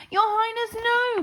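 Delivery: high, loud pleading; begging cook. A narrator's reading. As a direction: plead desperately. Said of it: "begged the cook".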